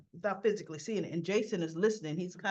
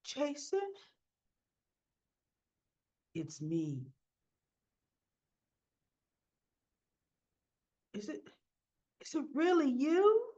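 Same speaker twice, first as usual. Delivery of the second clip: disbelieving reunion; 6 semitones higher than usual. Retold or imitated. Carly's reunion with Jason retold.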